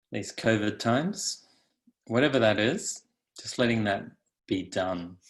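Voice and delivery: calm, unhurried guidance with long pauses; guided meditation. Acted. Teacher settling meditators into the body, calm guided meditation. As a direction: lead them gently into stillness.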